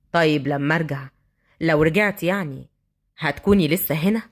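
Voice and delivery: low, hesitant man's voice; tentative street thug. Acted. Thug asking if girl will wait, low tentative voice. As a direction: ask it low, guardedly.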